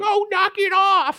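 high, drawn-out whine; exaggerated squeaky exasperation; frustrated kid. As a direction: imitate a pestered schoolboy pleading to be left alone. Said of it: "I'm getting so frustrated".

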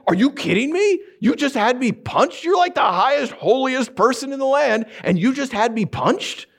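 high-pitched, incredulous outrage, dragging out the accusation; acted affronted protest; indignant outburst. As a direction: lash out in disbelief. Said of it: "He lashes out".

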